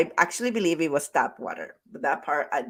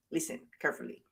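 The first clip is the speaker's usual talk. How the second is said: dropped to a near whisper; hushed aside; 3 semitones lower, 13 dB quieter, much faster than usual. Real, not acted. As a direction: lean in and say it softly. Coach leans in before key point.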